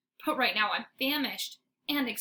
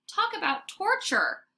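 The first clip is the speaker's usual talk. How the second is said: dramatic despair; 10 semitones higher than usual. A narrator's reading. Dog despairing over food.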